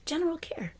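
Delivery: breathy whispered "General care", drifting off; soft whispered "General care"; whispered repeat. Shown as a demonstration. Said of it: "Just general care".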